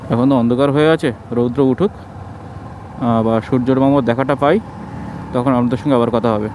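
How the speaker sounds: muffled behind a mask; through a face mask. Real, not acted. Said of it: "কারণ মুখে mask পরা আছে".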